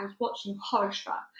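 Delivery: ordinary delivery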